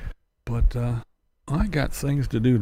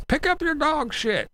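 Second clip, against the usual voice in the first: high mock scold of a cranky neighbour; shrill-free mock old-timer griping; cranky old man. Still mocking bitter old people, cranky old-man gripe.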